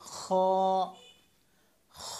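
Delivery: খ as a rasped fricative from the end of the throat; upper-throat kh. Shown as a demonstration. Teacher showing where in the throat, fricative kh. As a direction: scrape the kh of খ, hold the vowel. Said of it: "হলকের শেষ থেকে".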